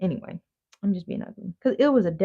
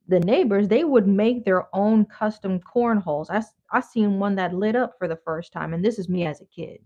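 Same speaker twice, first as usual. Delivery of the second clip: usual speaking voice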